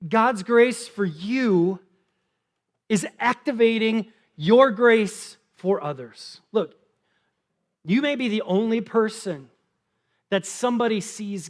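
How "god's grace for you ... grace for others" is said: God's grace for you drawn out slowly; slow emphatic repeat. Shown as a demonstration. Pastor repeats the point for note takers, very slow. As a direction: let each word land. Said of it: "you can write this down".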